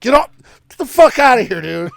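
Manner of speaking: sharp shout across the room. Real, not acted. Host suddenly yells at someone disturbing him, loud. Yelling at pet off mic.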